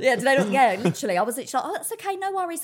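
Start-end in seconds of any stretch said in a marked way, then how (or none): 1.7-2.6 high, light and easygoing; imitating own sweet phone manner; breezy phone voice. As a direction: reassure a caller in a pitched-up voice.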